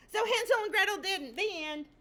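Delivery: eager chicken crashing the story; excited interruption. Acted. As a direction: burst in high and loud.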